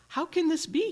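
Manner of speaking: high, delighted exclamation; amazed cry. A narrator's reading. The king amazed at his own joy, crying out high. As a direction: burst out in happy wonder. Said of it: "he cried".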